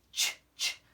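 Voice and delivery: Ch, ch as bare breathy sounds; teacher isolating the ch sound; unvoiced ch. Shown as a demonstration. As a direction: hiss the ch sound without voice. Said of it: "I'm gonna slow down there".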